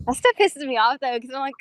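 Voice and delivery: normal talking voice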